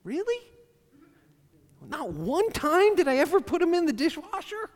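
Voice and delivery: high, loud incredulous rise; mock the accusation with disbelief; sarcastic spouse snapping back.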